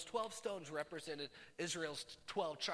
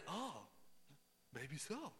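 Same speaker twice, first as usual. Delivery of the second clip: small, soft put-on concession; meek mock reply; 2 semitones lower, 8 dB quieter, slower than usual. Prophets answering Elijah's taunt, meek and quiet. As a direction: drop the volume as if doubtfully giving in.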